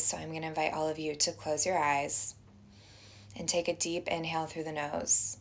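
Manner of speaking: soft, unhurried meditation voice with long pauses; guided meditation. Acted. Teacher inviting everyone to close their eyes and breathe, guided meditation.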